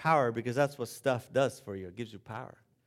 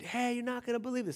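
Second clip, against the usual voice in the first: calling out; 8 semitones higher, 5 dB quieter, faster than usual. Retold or imitated. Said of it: "they yell out at the gate".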